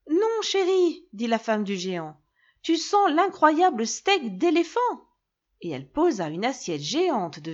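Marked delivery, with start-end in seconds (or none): 0.1-1.1 high giantess voice. A narrator's reading. Giant's wife answers husband. 2.6-4.8 high giantess voice. A narrator's reading. Giant's wife answers husband.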